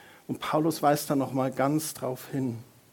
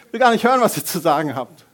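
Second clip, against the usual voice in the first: mock defiance; 2 semitones higher, 9 dB louder, faster than usual. Joking about fasting from leaders.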